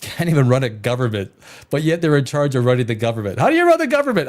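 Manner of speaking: ordinary talk, nothing marked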